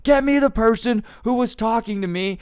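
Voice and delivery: very high, loud, furious demand; acted-out outburst at the manager; imitated screaming. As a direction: shriek it as the enraged customer. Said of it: "'cause she's screaming".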